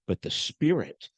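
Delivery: ordinary talk, nothing marked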